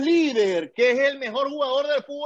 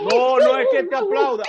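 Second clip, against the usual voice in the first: sudden high, loud protest; outburst; 13 semitones higher, 5 dB louder than usual. Real, not acted. Arguing with his friend about Messi, a loud, high protest. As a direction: jump in and shut the idea down. Heated football argument, rebuttal.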